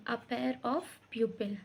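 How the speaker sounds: "a pair of pupil" spelled out very slowly, word by word; slow dictation. Shown as a demonstration. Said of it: "तो हम वहाँ बोलेंगे".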